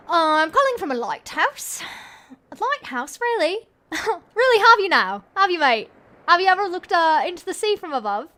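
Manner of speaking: loud, high British bloke on the phone; chirpy British accent, mate. Acted. Caller on the lighthouse phone, in a British accent. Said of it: "that British voice is spot on".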